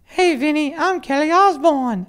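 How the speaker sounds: very high, loud, slow mock English Kelly; English accent Kelly. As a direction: shriek it like a cartoon English Kelly. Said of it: "how do you do an English accent".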